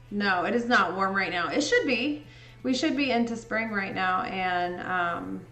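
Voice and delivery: ordinary delivery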